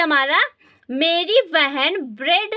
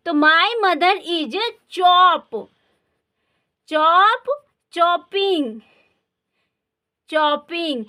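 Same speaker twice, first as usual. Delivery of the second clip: slow dictation; much slower than usual. Shown as a demonstration. Teacher dictating a sentence.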